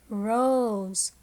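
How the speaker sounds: "Rose" drawn out; slow repeat of "Rose" with a stretched vowel; long O in rose. Shown as a demonstration. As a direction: linger on the O in "Rose". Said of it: "words that have the long sound".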